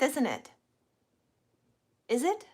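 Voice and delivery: ordinary delivery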